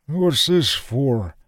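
put-on high, slow old man in amazement; astonished frail old voice; amazed. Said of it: "Parpin said in amazement".

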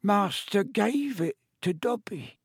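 high, slow awed elf voice; house-elf marvelling at the sock; elf in wonderment. A narrator's reading. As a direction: marvel at it like a treasure. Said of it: "in wonderment".

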